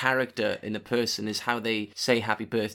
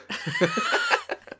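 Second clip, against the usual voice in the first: laugh. Real, not acted. Laughing at own bad accent.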